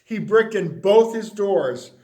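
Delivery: ordinary delivery, nothing marked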